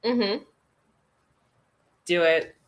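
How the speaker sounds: normal talking voice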